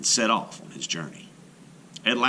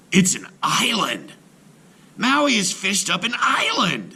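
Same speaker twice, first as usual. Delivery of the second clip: much higher, louder astonished cry; amazed shout; 11 semitones higher, 4 dB louder than usual. A narrator's reading. Brother astonished at Maui's island, amazed shout. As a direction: marvel at the island.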